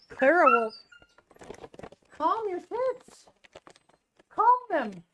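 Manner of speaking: high-pitched mock scold at an overexcited pet. Real, not acted. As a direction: scold the dog in a squeaky pet voice. Scolding excited dog.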